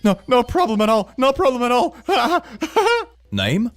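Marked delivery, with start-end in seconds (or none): 0.0-3.3 nervous laughing. Acted. Nervous forced cheer.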